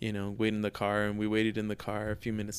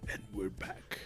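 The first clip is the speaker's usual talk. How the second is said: menacing voice; 2 semitones lower, 12 dB quieter than usual. Shown as a demonstration. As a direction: say it like a quiet threat. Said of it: "I think it's a menacing voice".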